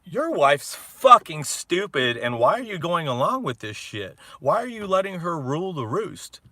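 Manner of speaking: loud mock disbelief; friends' incredulous yelling. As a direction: retell friends shouting at him. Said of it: "they were like".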